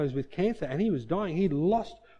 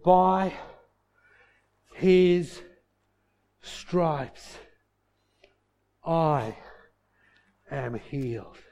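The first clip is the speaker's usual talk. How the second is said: halting words with long gaps, fading at the end; acted exhausted sick man, one word at a time; out of breath, barely talking; much slower than usual. Dying uncle gasps out his faith to Keith, barely able to talk. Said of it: "he can barely talk because it took all his strength".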